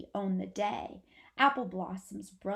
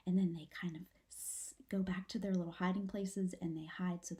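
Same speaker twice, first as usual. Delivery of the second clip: whisper. Real, not acted. Teacher whispering to her class, hushed whisper. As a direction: keep it all in a hushed whisper.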